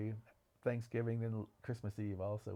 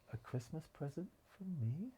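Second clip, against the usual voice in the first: a soft, higher, disbelieving question; surprised housekeeper; 6 semitones higher, 6 dB quieter than usual. Retold or imitated. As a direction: ask it quietly, as if the gift can't be real. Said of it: "she goes".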